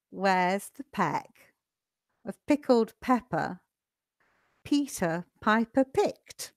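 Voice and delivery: slow line-by-line tongue twister with long pauses. Shown as a demonstration. Teacher has students practice along line by line, slowly. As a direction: break it into small, careful chunks for repeating. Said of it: "Let's do it slowly together".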